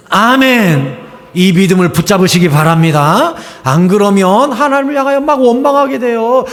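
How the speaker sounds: ordinary talk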